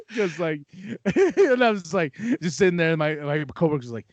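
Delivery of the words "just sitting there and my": ordinary talk, nothing marked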